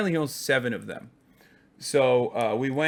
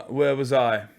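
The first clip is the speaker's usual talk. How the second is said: normal talking voice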